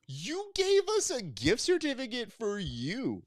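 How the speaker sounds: very high, drawn-out complaint; exaggerated high-pitched indignation; retold indignant retort. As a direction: protest slowly in a high mock-outraged voice. Retold quarrel with company.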